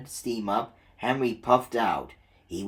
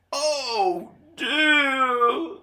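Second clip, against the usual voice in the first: dismayed engine; 13 semitones higher, 4 dB louder than usual. Acted. Gordon dismayed.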